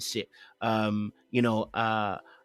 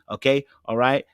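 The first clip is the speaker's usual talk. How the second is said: normal talking voice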